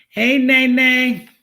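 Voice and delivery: high, loud, slow call across the room. Real, not acted. Vlogger calling out to Nay Nay mid-talk, loud. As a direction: call her name from afar.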